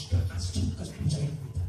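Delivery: hushed, voiceless threat under the breath; soft, whispered acted grumbling; muttered grudge. Acting out a stubborn grudge.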